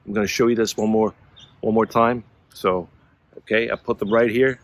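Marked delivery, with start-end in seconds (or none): none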